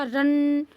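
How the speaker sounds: रन with a long humming n; drawn-out anusvara. Shown as a demonstration. Teacher swapping the first letter, held nasal. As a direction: stretch the n in रन. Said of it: "इस तरीके से".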